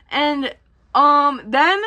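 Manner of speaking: ordinary delivery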